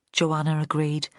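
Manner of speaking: plain reading voice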